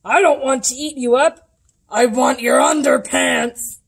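loud, booming dinosaur roar; hammed-up monster bellow; roaring T-Rex. A narrator's reading. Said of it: "he roared with deafening rants".

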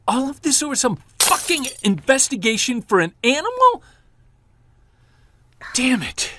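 high, strained furious shout; angry outburst. Acted. Husband venting at his wife, furious. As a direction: lash out in frustration.

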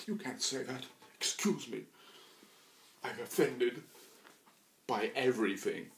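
high, slow, shrill-free mock outrage; indignant. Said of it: "Make everyone nervous".

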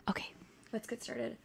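soft unvoiced whisper. Real, not acted. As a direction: whisper it close to the mic. ASMR whisper aside.

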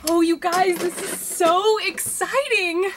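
loud, thrilled gush; bursting with excitement, slowing to savour it; excited. Acted. Assistant thrilled the boss is on TV, loud gushing.

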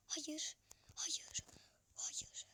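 nefesli fısıltıyla hayır; sessizce, çaresiz fısılda. Real, not acted. Hayal kırıklığıyla fısıltı. (kaçan gole çaresiz fısıltı)

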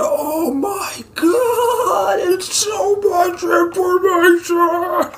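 high, drawn-out mock crying; hammed-up squealing agony; overwhelmed wail. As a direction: wail it slowly, very high, as if overloaded. Character overwhelmed by wave of data.

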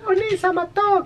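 put-on very high girly echo; imitación femenina. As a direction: mimic a cutesy anime girl. Imitates character's feminine switch. (imitando voz de chica)

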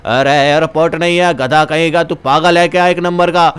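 put-on low old man telling someone off; low-pitched elder's rebuke; scolding old villager. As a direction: play an old local calling the visitor a fool.

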